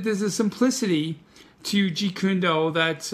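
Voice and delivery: ordinary delivery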